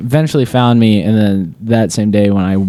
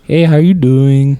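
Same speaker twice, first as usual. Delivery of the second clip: put-on Minnesota accent; 6 semitones higher, 5 dB louder than usual. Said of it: "he had the accent and everything".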